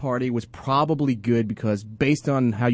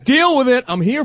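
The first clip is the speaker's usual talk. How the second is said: defiant mock shout; 9 semitones higher, 5 dB louder than usual. Joins the defiant son-in-law bit.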